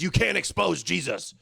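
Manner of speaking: usual speaking voice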